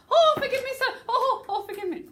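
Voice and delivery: high, loud flustered pleading; mortified. Shown as a demonstration. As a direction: demonstrate a panicky apology. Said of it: "Can you have him mortified".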